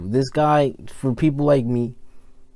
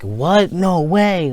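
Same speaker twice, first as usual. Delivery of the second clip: mock amazed; 5 semitones higher, 4 dB louder, much slower than usual. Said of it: "not like a".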